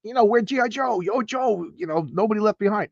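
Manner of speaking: high, insistent refusal to leave; defiant soldier. Retold or imitated.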